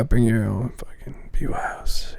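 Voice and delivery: soft, whispery echo; hushed repeat. Retold or imitated. Co-host echoing the promoter's pitch, whispered. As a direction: drop to a voiceless whisper, trailing away.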